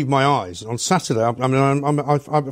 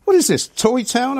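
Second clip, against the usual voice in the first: scornful; 11 semitones higher, 3 dB louder than usual. Retold or imitated. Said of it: "and it was like".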